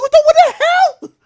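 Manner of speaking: high, loud, startled yelp of disbelief; shocked disbelief. Retold or imitated. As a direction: blurt it out as if you can't believe your eyes. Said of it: "I'm like".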